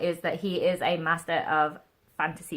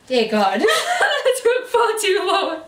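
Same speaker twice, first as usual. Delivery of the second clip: relieved laughter; 13 semitones higher, 4 dB louder than usual. Real, not acted. Relieved laugh after finishing.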